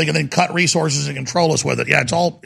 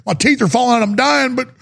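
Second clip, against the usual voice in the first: much higher, pleading mock voice; mocking whiny citizen; 10 semitones higher than usual. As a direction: ham up a desperate, helpless victim.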